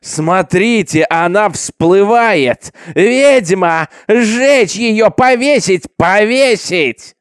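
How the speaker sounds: high, drawn-out, bloodthirsty shouts; roaring mob. A narrator's reading. Crowd sees her surface and demands death, roaring. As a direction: bellow each cry for all to hear. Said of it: "толпа ревела на берегу".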